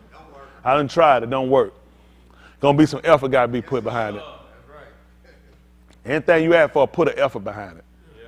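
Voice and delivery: ordinary talk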